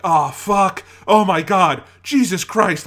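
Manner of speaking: loud, high shocked outburst; blurted, high-pitched reaction-video yelling; retold yelling at the TV. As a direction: yell curses at the screen in disbelief. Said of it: "like, yelling at the T V".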